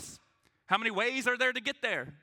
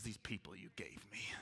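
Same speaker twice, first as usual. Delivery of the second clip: whispered, softer weary complaint as Moses; 13 semitones lower, 18 dB quieter than usual. Retold or imitated. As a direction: mutter it like an exasperated Moses. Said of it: "I feel like Moses".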